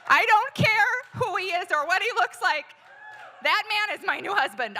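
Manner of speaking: very high, loud angry yelling; yelled rant. Retold or imitated. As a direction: keep screaming, totally fed up. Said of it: "I yelled at him".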